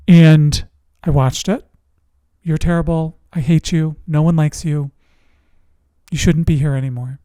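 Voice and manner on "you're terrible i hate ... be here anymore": ordinary talk, nothing marked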